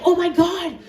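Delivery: high, loud urgent question; alarmed nurse. Acted. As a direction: exclaim it in a panic.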